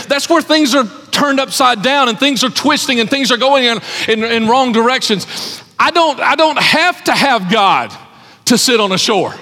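rising fervor, hammering each point to the congregation; preaching at full force. Acted. Preacher building through storm passage.

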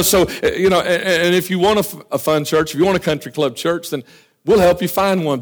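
ordinary delivery

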